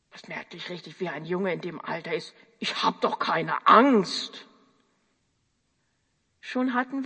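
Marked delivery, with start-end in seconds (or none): 2.6-4.5 put-on slow, defiant boy insisting he's unafraid; boyish denial. Young boy on phone to Juliane, slow defiant boy. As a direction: put on a young boy's brave protest. Boy denies being afraid.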